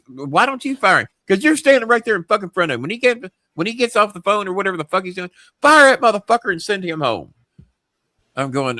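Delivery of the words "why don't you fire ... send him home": fast, high-pitched irate boss impression; furious. Boss demanding he fire Mark himself, furious. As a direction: rattle it out angrily, ending on a loud order. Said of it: "they're fucking furious".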